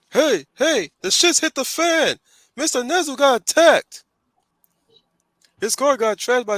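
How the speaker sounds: high, loud excited goon. Acted. Guard bursting in to tell the others, excited high goon voice. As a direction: play a cartoon henchman raising the alarm. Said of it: "I'm doing the goon voice".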